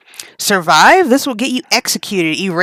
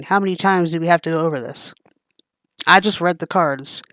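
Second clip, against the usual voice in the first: ordinary delivery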